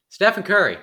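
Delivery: announcing the headline loudly and high; big news reveal; excited. Real, not acted.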